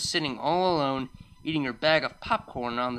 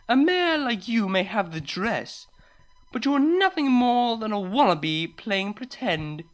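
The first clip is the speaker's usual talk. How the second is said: snobbish mockery; 7 semitones higher, 4 dB louder than usual. Unicorn belittling the farmer before a crowd, mocking. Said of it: "The snobbish unicorn only laughed and pointed".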